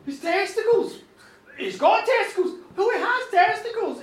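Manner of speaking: high, loud astonished echo; comic acted astonishment; shocked outburst. King reacts in shock, acted.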